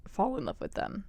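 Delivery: the voice breaks on the words; voice crack. Real, not acted. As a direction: voice cracking mid-phrase. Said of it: "voice crack".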